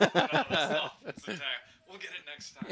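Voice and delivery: loud laughing retelling. Retold or imitated. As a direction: laugh through the punchline. Retold official, laughing loudly.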